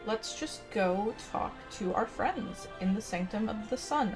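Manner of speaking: normal talking voice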